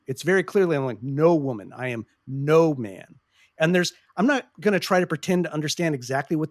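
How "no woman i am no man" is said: slow, each "no" pressed down; stress on no. Shown as a demonstration. Host repeating the lyric to show its stress on no. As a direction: hit "No" in "No woman" and "no" in "no man". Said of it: "The emphasis is on the no".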